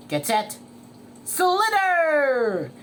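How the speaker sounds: referee's call building to a high, loud yell; shouted start call. Acted. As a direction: send the racers off, stretching the last word. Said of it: "shouted the referee".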